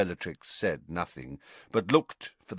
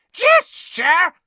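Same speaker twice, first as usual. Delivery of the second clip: high, loud outraged shriek; 14 dB louder than usual. A narrator's reading. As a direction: shriek the word in disbelief. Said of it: "She shrieked".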